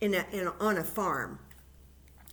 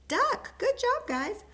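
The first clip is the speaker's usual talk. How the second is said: excited praise; 3 dB louder than usual. Real, not acted. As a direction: cheer them on with delight. Teacher praising children.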